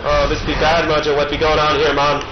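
mock voodoo priest. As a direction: spook it up like a hammed-up shaman. Voodoo-style mojo talk to Mom.

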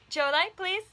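loud high begging voice; pleading baby voice. Pretend. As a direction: beg like a little child. Begging voice for toddler.

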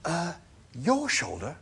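a touch of horror, hesitant; horrified. A narrator's reading. Arthur questioning the animal's offer, mildly horrified. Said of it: "in a horrified whisper".

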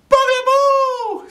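put-on very high, loud babyish squeal; baby repeating the burglar's name. A narrator's reading. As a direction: say it as a babbling toddler. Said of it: "says the baby".

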